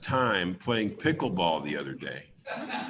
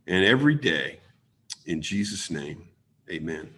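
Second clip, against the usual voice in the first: prayer; 4 semitones lower than usual. Acted. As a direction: lead the room in heartfelt prayer. Opens meeting with a prayer.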